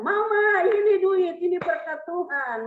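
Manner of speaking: high, excited call to a spouse; loud call. Acted. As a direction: sing out good news across the house. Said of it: "panggil kencang-kencang".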